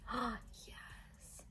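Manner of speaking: soft unvoiced whisper. Real, not acted. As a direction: whisper your approval. Whispered delight.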